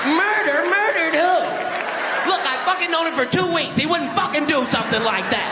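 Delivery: high, shocked "murder?" and a loyal defence; lightly voiced indignant buddy; mock disbelief.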